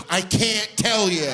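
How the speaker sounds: put-on high, slow sighing little boy; weary childish refusal; sighing kid. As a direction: sigh it out, worn down. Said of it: "I'm just sighing".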